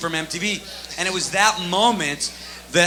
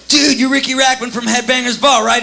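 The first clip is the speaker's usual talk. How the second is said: excited fan; 7 semitones higher, 7 dB louder than usual. Retold or imitated.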